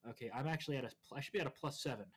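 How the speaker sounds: ordinary delivery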